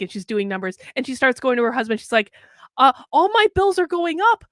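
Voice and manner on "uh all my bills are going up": higher, louder whiny complaint about rising bills; mock the wife's fretting list of bills; complaining wife.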